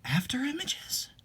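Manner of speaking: a soft, breathy whisper, puzzled; whispered question. Acted. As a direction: whisper it back, wondering.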